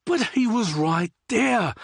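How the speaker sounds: high, slow drawn-out complaint; whiny protest. Acted. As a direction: stretch it out, sulky. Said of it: "Liam continued to protest".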